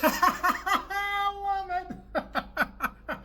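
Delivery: laughing high and drawn out; cracking up in delight. Real, not acted. Laughing at the caller's clip, delighted.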